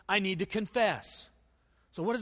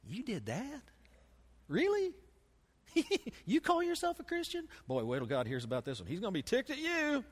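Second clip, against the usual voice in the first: mocking, sneering voice of the enemy, higher on the jabs; a lightly voiced devil on your shoulder, teasing; taunting accuser voice; 6 semitones higher than usual. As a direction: needle the listener like a sly tempter. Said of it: "the enemy has a tendency to whisper in your ear".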